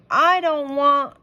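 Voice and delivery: high, loud, slow protest; cut-off whine. A narrator's reading. Beatrice protesting as Henry leaves, high whine. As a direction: wail it and get cut off. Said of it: "as she started to say".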